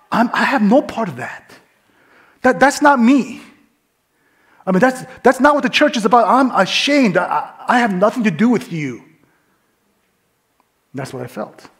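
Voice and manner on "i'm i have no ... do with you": higher, louder, distancing himself; defensive protest. Retold or imitated. Pastor disowning the rude woman before the cashier, raised protest. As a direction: insist it isn't you. Imagined protest under judging eyes.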